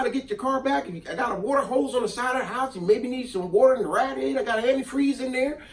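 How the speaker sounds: hammed-up Southern neighbor offering help; country neighbor voice. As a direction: play the kind Southern neighbor, easygoing. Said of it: "He probably didn't talk like that for one".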